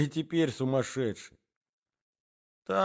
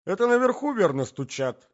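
frightened wife; 10 semitones higher, 6 dB louder than usual. A narrator's reading. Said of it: "испугавшись".

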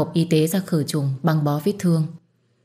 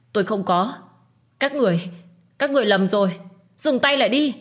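high, frightened begging to stop; desperate pleading; 5 semitones higher than usual. Acted. Hương begging the attackers to stop, frightened plea. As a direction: cry out for them to stop hitting. Beaten girl begs crowd to stop.

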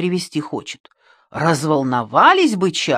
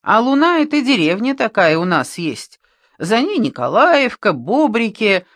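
ordinary delivery, nothing marked

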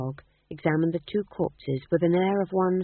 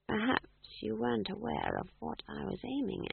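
soft menacing whisper, much softer; 5 semitones higher, 10 dB quieter than usual. Acted. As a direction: breathe it close to the dying man. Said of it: "she whispered".

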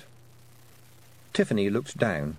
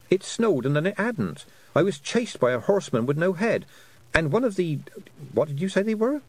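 a higher, eager girl's voice, lightly voiced; young girl Tiffany; 9 semitones higher than usual. Tiffany telling the toad her troubles, higher and eager. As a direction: sound like a flustered young girl.

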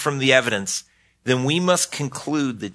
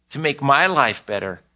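ordinary talk

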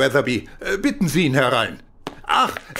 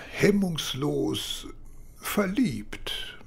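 suggestive whisper; 3 semitones higher, 7 dB quieter, much slower than usual. Acted. Suggestive remark about affair.

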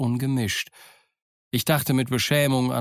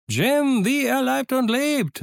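much higher, loud triumphant cry; Silver crowing; 13 semitones higher, 3 dB louder, slower than usual. A narrator's reading. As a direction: crow it out in triumph. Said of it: "rief Silver".

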